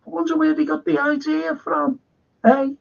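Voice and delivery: high, mock-innocent wondering; sarcastic. Real, not acted. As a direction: feign innocence to land the dig. Sarcastic jab at Japan copying.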